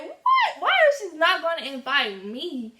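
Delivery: shrieking high and loud in disbelief; indignant squeal of surprise; shocked outrage. Retold or imitated. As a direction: hit the roof of your voice, outraged. Learns she wasn't invited.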